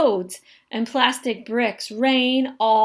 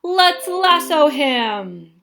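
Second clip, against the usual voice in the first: shout; 4 semitones higher, 5 dB louder, slower than usual. A narrator's reading. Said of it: "Noah shouts".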